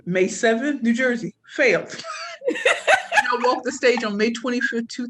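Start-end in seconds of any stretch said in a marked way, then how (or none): 2.5-5.1 higher, slower, laughing; slow, laughing, higher pitch; laughing through it. Real, not acted. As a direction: laugh as you tell it. Laughing while recalling graduation.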